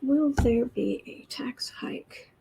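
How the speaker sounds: slow whispered question. Real, not acted. Asking the cards about the tax hike, slow whisper. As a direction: whisper the question slowly to yourself.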